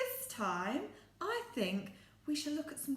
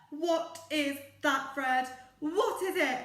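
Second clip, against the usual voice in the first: loud, eager what is it; mock-excited asking; excited question; 3 semitones higher, 8 dB louder than usual. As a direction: call out in hammed-up excitement. Teacher asks puppet excitedly.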